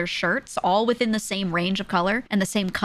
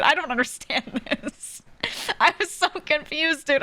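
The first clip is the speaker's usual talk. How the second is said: ordinary talk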